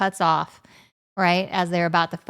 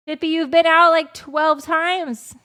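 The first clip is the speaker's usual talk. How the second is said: high, loud whine at the dog; exasperated protest; 9 semitones higher, 5 dB louder than usual. Real, not acted. Owner telling Pippi she has been out already, exasperated. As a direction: draw it out in exasperation.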